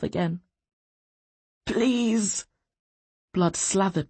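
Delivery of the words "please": mildly higher, drawn-out plea; pleading. A narrator's reading. Beaten prisoner to his captors, pleading. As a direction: beg weakly for mercy.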